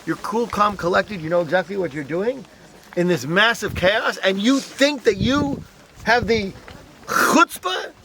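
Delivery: imitating a furious guard. Guard berating Hugo Lowy in retold scene.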